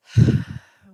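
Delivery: big audible exhale; loud relieved sigh; loud sigh of release. Real, not acted. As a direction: let it all out in one sigh. After crying release.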